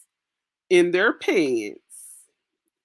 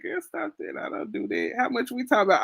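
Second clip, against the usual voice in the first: mock coy voice; 6 semitones higher, 4 dB quieter, faster than usual. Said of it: "She said".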